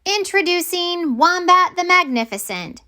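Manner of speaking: high showman's announcement; proud ringmaster announcement; grand announcement. Acted. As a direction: present him like a circus act. Wilma proudly announces her wombat.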